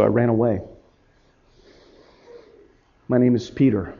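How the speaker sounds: low, hushed and heavy with regret; quiet, low admission of shame; subdued confession. Acted. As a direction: confess it softly, with long pauses. Peter confesses his desertion.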